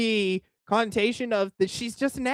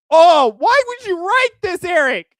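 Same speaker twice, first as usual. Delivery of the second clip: much higher and louder, a disbelieving cry; incredulous shout; 12 semitones higher, 9 dB louder than usual. Real, not acted. Streamer reacting to a guest's tweet about his wife: incredulous shout. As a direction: yell at him in disbelief.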